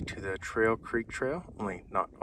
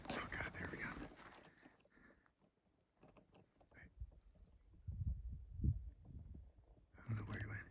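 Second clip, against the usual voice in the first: whispering; 16 dB quieter than usual. Real, not acted. Just saw a bear nearby.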